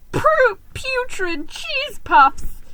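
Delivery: high, slow stammering shriek in pain; high stuttering squeal of pain. A narrator's reading. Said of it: "I shrieked".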